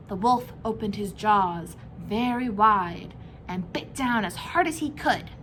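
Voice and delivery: ordinary delivery, nothing marked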